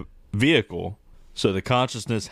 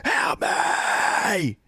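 unvoiced, higher strained cry; whisper yelling; 7 semitones higher than usual. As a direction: scream it under your breath. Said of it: "screaming, but it was kinda quiet".

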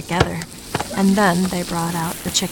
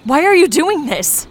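high, loud, panicked question; desperate shout at the attacker; frightened cry; 10 semitones higher, 8 dB louder than usual. Acted. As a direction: cry out in fear and confusion. Victim pleads with attacker.

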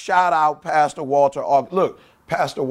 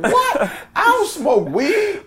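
high, startled protest through laughter; laughing mock outrage; shocked denial; slower than usual. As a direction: squeak it out in disbelief. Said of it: "I said".

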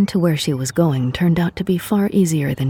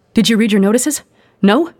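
bursting with news, higher and louder; excited; 7 semitones higher, 6 dB louder than usual. A narrator's reading. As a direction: ask eagerly, barely containing news. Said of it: "practically bouncing".

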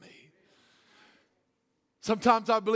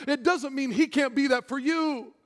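ordinary delivery

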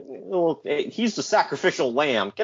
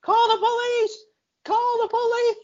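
very high shrieking mock panic; hammed-up frantic yell; panicked shout. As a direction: scream for help in terror. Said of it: "shouts for someone to call the police".

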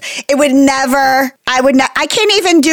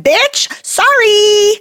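high, drawn-out sassy comeback; imagined clapback, slow and high-pitched; sassy retort; 8 semitones higher, much slower than usual. Retold or imitated. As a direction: stretch it out high with mock apology. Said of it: "And I'd be like".

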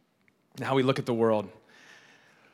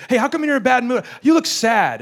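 put-on high, loud child voice; imitated blunt kid; 10 semitones higher, 6 dB louder than usual. Kid noticing an adult's mood, imitated blunt kid. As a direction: call it out like a tactless kid.

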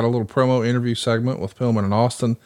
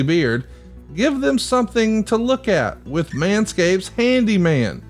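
upbeat sponsor read, a little higher; 5 semitones higher than usual. Acted. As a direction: pitch the product like a host ad. Host reads sponsor ad.

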